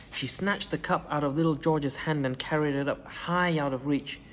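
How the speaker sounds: plain reading voice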